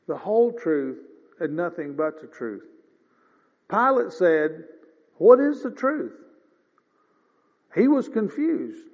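ordinary talk

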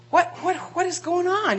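mock bewilderment. Peter on the rooftop wondering at the voice, bewildered. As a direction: act out baffled thoughts. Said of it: "he's thinking".

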